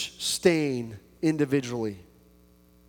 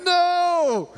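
high, drawn-out stubborn no; husband stubbornly denying his wife's point; childish denial; 14 semitones higher, 4 dB louder than usual. Retold or imitated. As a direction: refuse like a sulky child. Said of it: "I'm like".

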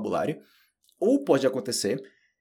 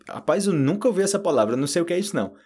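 baffled reply; 6 semitones higher, 4 dB louder, faster than usual. Retold or imitated. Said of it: "E eu".

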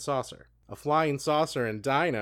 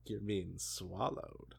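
much softer, slow mock-seductive delivery; sultry voice; 2 semitones lower, 9 dB quieter, much slower than usual. Reading the vore part for laughs, slow sultry whisper-soft voice. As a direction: breathe the words out, joking sultry. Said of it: "bust out our sultry voices".

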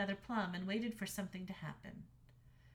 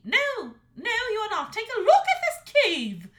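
very high, loud shriek; 13 semitones higher, 14 dB louder than usual. A narrator's reading. Mother dragon shrieking at her son about the cave. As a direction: shriek at him in outrage. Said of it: "she shrieked".

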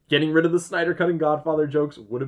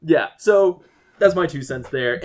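usual speaking voice

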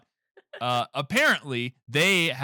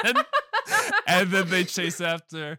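laughing while talking; 5 semitones higher than usual. Real, not acted.

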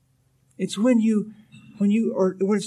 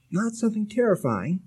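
normal talking voice

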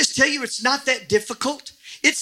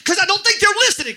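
high, fast burst of exasperation; parental yelling; 9 semitones higher, faster than usual. Shown as a demonstration. Preacher showing how parents talk to kids, yelling. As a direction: raise your pitch and rush it at the kids. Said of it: "It's the way I talk to my kids".

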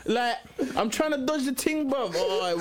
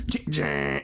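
low imitated dial click and whirr; rotary dial noise. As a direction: imitate an old phone dialling. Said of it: "what that noise is".

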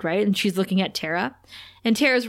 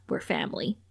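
soft, tender; 5 semitones lower, 8 dB quieter than usual. Retold or imitated. Buffy telling Tara's dad, hushed and tender. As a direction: lower and much softer.

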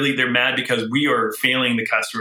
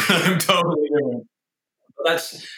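higher, said through a laugh; 4 semitones higher than usual. Real, not acted. Boss joking about being unlike predecessors, laughing. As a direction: laugh while getting the words out. Laughs at his own joke.

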